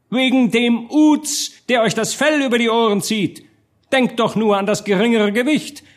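high, indignant public speech; heated harangue. A narrator's reading. Doctor warns burghers against the spendthrift duke, heated harangue.

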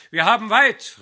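loud, high rallying call. A narrator's reading. Fiddler calling the band to set off, loud rallying shout. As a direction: rouse the whole company to leave. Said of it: "rief er".

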